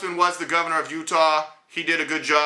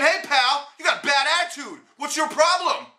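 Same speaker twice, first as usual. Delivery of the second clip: lightly voiced stern scolding; slightly stern call-out, no big change; imitating D-Lake; 9 semitones higher than usual. Clerk repeating his scolding as D-Lake would, lightly voiced stern. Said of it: "it was D-Lake from Prez saying".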